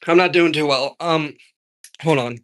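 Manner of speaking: struggling to talk past a cough; choking voice. Real, not acted. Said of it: "I can't talk".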